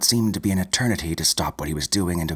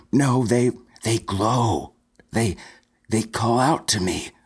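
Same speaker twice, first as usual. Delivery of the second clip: higher, broken stammer in awe; 4 semitones higher than usual. A narrator's reading. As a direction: stumble over they, entranced. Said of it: "Stuart stammered".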